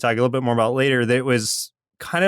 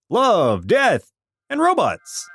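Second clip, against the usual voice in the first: excited announcing; 7 semitones higher, slower than usual. Acted. Excited show title announcement.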